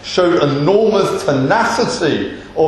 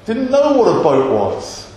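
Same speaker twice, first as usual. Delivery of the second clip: ordinary talk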